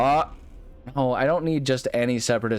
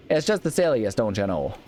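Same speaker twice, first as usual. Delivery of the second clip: put-on hardcore Midwestern accent. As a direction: go full Midwest, pitch up on "just". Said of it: "really going hardcore on the whole Midwestern".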